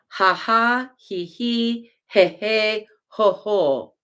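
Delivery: ha ha he he ho ho, voiced as laughter; demonstrating the modes of laughter; laugh syllables. Shown as a demonstration. Teacher demonstrating the eight modes of laughter. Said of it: "eight modes of laughter".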